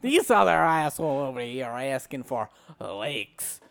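laughing through a fake French accent that slides toward Russian; half-laughing French zookeeper accent; put-on French accent going Russian; drifting mock French accent. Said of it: "you sound more and more Russian".

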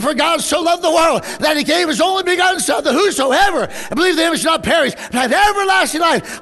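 high, loud, ringing recitation; preached scripture. Acted. Soul winner quoting John to Maddie, full-force preaching. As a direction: proclaim the verse at full cry.

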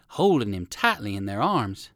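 usual speaking voice

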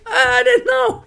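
high, giggly confession; squeaking with laughter, defending himself; laughing protest. Retold or imitated. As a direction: laugh out the excuse. Retelling, still laughing.

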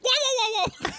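high wailing harmonica wah-wahs; high vocal harmonica imitation; imitated harmonica. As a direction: make the mouth a harmonica, way up high. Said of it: "You know what a harmonica sounds like?".

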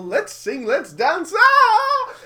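building to a high, loud sung-out cry; mock jovial. Recapping a musical's plot, mock joyful outburst. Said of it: "in a jovial, joyful".

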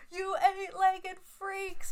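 slow, high, drawn-out movie-villain yell; hammed-up movie line; David Arquette quote. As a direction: stretch it out like a cheesy action hero. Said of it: "David Arquette going".

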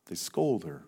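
low, soft repeat; hushed, sorrowful echo; quiet. Shown as a demonstration. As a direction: let it fall to a hush. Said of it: "repeat said low and soft".

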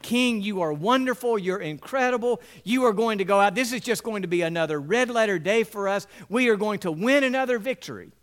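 butter up the king with loud, eager praise; hammed-up cheering flattery; gushing flattery. Advisors flattering king before battle.

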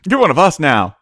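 high, loud welcoming voice; loud, high "one of us" welcome; mock welcome. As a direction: greet the new member like a cult. Welcoming someone into the group.

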